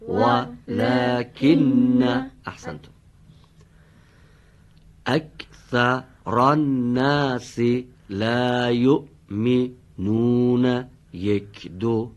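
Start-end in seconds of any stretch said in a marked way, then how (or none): 0.1-2.0 slow chanted Arabic verse; Quran recitation. Shown as a demonstration. Teacher models recitation. 5.1-10.8 slow chanted Arabic verse; Quran recitation. Shown as a demonstration. Teacher models recitation.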